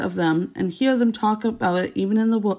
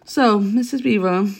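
plain reading voice

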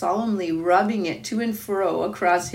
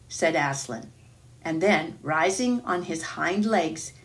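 plain reading voice

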